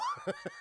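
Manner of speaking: short, very high laugh; high giggle. Real, not acted. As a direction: squeal with amusement. Laughs at impression.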